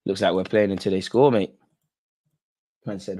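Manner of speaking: usual speaking voice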